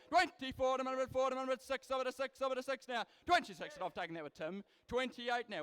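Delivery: rapid rhythmic auction chant, punching prices; auctioneer. Acted. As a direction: rattle off bids like a livestock auctioneer. Live cattle auction bid calling.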